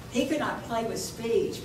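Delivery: ordinary talk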